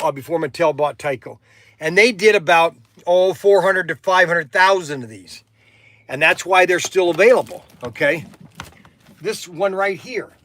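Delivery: normal talking voice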